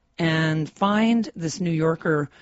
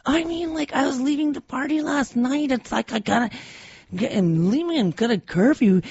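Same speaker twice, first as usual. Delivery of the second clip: put-on much higher, exasperated teenage whine; frustrated teen daughter; 9 semitones higher than usual. Mother imitating her daughter complaining about the curfew, higher and exasperated. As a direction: imitate a fed-up sixteen-year-old pleading her case. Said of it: "she was so frustrated at this point".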